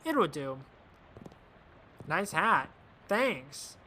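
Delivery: usual speaking voice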